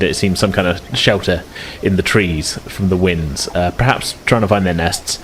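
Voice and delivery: ordinary delivery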